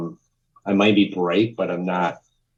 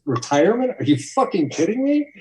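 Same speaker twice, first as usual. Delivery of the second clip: higher, louder, mock disbelief at retirement; incredulous mock outburst; 13 semitones higher, 3 dB louder than usual. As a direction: throw it back as if the idea were absurd. Retelling Todd's incredulous outburst.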